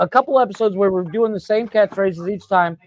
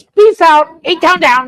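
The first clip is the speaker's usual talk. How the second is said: high, loud, goofy sign-off; squeaky catchphrase shout; high mock catchphrase; 8 semitones higher, 6 dB louder, slower than usual. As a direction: belt it out like a silly outro. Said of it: "It could be as easy as".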